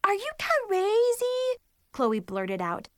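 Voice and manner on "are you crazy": high, slow, stunned question; shocked disbelief. Acted. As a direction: gasp it out in disbelief. Friend shocked at Nikki.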